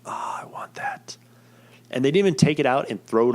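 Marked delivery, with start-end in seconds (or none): 0.0-1.2 slow, breathy longing whisper; wistful whisper. Retold or imitated. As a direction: whisper it with mock longing, drawn out. Longing retold for confiscated food.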